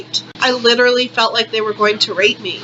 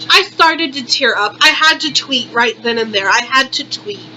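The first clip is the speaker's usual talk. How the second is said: ordinary talk, nothing marked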